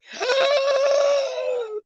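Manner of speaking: high gasping shout of laughter. Real, not acted. Streamer cracking up at her own joke. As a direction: gasp and break into laughter. Laughs at Bill Cosby line.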